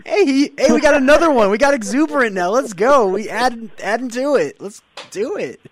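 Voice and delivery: high, loud cheering; pumped-up rallying shout; excited hype. Acted. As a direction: whoop it up like a hype man. Co-host hypes up another E word.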